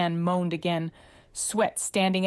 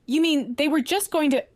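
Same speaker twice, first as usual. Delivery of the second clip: high, horrified disbelief; 10 semitones higher, 5 dB louder than usual. Acted. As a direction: gasp it out in horror. Inez realizes in horror.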